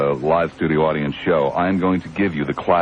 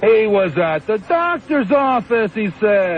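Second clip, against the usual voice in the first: much higher put-on teasing voice; squeaky comic persona needling a guest; falsetto comic character; 14 semitones higher, slower than usual. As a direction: tease the caller in a silly high voice.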